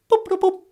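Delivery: very high beeping noises; computer beeps. Acted. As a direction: beep like an old machine.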